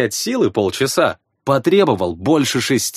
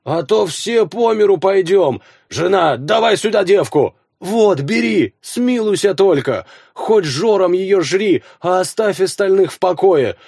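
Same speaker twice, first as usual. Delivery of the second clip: begging peasant; 6 semitones higher than usual. Acted. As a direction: bargain desperately for mercy. Peasant begs and offers daughter.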